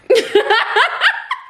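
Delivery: high laughing burst; burst of laughter. Real, not acted. Laughing at a comment comparing her to Groundskeeper Willie, high burst of laughter. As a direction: crack up at the comparison.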